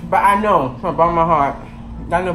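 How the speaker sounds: chewing while talking; talking around a bite; mouth full. Real, not acted. Seen 1: Cheeks puffed, mouth working on bread bitten just before.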